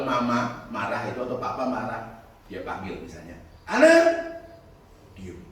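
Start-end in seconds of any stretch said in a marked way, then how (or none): 3.7-4.2 put-on a furious parent shouting a child's name, loud and high; angry parent's call. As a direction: yell the name like an angry mum. Parent angrily calling child. (teriakan marah orang tua memanggil anak)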